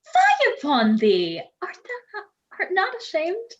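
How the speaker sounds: scandalized rebuke. Acted. Bride scolding her maid for a bawdy joke, scandalized. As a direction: scold as if shocked by naughty talk.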